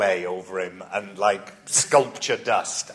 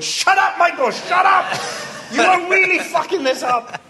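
high, loud squeaky inner voice yelling; a tiny frantic voice yells at Michael; inner voice panicking; 6 dB louder than usual. Retold or imitated. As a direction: shriek at yourself in panic. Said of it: "a little voice was going".

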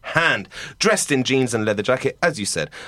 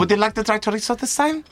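higher, sing-song waiter pushing chorizo; sweetly upsell the chorizo once more; pushy waiter offer; 9 semitones higher than usual. Acted.

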